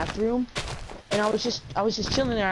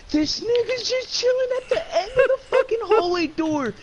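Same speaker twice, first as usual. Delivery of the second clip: high-pitched incredulous squeal; squeaky, worked-up storytelling; exasperated disbelief; 13 semitones higher, 4 dB louder than usual. Real, not acted. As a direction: blurt the punchline way up high. Punchline, finding teacher still waiting.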